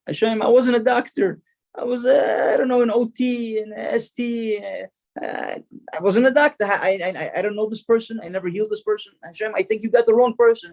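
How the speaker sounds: high-pitched, flustered denial; acted confusion, voice pitched well up; bewildered protest. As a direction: stammer and protest as if wrongly credited.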